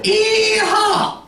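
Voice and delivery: high, whooping mock Southern yell; Deep South holler. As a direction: let out a piercing Southern whoop. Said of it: "they make a sound".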